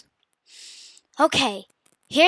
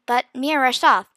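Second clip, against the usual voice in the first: plain reading voice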